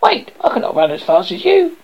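high, urgent frog call; croaking frog. A narrator's reading. Frog left behind by running princess, high croaking call. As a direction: call after her as a little frog. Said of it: "frog croaked after her".

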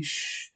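soft "shh" to hush someone; quiet, drawn shushing sound. Acted. Hushing an interrupting speaker.

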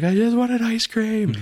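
high, loud, slow mock crying wife; mock sobbing wail. Pregnant wife wailing over missed ice cream, mock crying. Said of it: "She's like".

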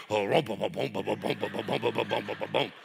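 rapid rhythmic "rum-bum-bum" stomps; imitated stomping. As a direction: imitate feet drumming on a wooden floor. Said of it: "then it would get faster".

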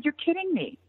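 high retold disbelief; disbelieving. Wrestler told her whole speech is cleared, disbelieving. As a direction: react with a high, amazed pitch.

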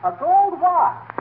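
gasping with greed over a gold watch; thrilled discovery. Acted. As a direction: savour it like treasure found. McGee spots watch in gutter.

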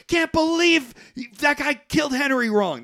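loud, high-pitched imitation of an argument; hammed-up angry shouting; mock yelling. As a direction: yell it way up high, as in a hammed-up row. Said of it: "they're yelling at each other".

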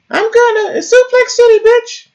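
very high-pitched squeaky wrestler impression; absurdly high cartoonish impression; Brock Lesnar. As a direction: squeal it out as a hammed-up wrestler catchphrase. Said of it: "it's Brock Lesnar".

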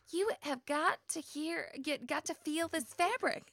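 put-on Linda's southern accent. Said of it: "doing a little impression of her".

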